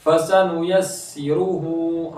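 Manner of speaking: फसनुयस्सिरुहु with only the last हु held long; stretched final हु. Shown as a demonstration. Teacher shows which letter stretches, long हु ending फसनुयस्सिरुहु. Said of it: "सिर्फ हा को खींचना है".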